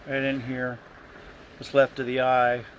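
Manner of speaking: ordinary talk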